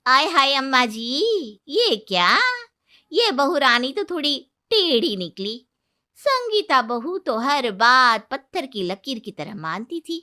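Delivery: maid clucking to her mistress about the new bride; gossiping maid. Acted.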